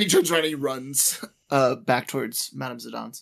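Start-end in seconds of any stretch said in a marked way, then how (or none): none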